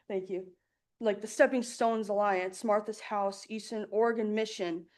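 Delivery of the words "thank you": relieved little laugh; nervous laugh. Real, not acted. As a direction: laugh out the nerves, thank softly. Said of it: "Just breathe".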